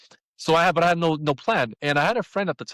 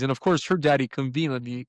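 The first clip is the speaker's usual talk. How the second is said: ordinary delivery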